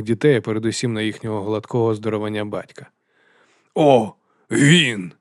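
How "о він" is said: loud, drawn-out exclamation; big booming cry of discovery; roaring chieftain. A narrator's reading. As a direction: roar it in recognition. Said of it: "проривів Крамок".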